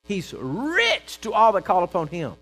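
loud, high, slow declaration; full-force proclamation. Real, not acted. Preacher drives the verse home, loud exultant proclamation. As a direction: ring it out to the back pews. Repeats verse loudly, then softly.

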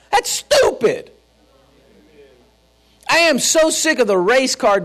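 high-pitched, emphatic preacher delivery; indignant pulpit declaration; fired-up preaching. Real, not acted. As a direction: preach it hard, pitch climbing to the end.

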